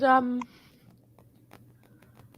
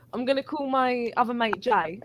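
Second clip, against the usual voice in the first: normal talking voice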